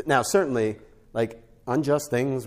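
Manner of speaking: ordinary talk, nothing marked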